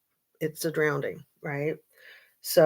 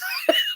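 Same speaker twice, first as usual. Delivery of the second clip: giggle. Real, not acted. Laughs after "it's ridiculous.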